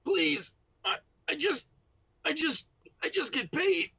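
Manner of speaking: high panicked begging; terrified pleading. Acted. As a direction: beg for your life, voice cracking. Captive begs for his life.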